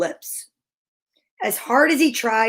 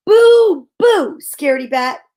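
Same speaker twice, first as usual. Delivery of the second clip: loud triumphant boo; shouted; 3 semitones higher, 7 dB louder than usual. Acted. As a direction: shout it after the fleeing bat. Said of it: "Ben shouted after him".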